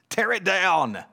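loud, high mob cry; acted jeering shout, pitched well up. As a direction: shout it like a gloating crowd calling for ruin. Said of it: "They were shouting out and saying".

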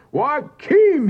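furious call, pounding the door; bellowing a name. A narrator's reading. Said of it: "he bellowed".

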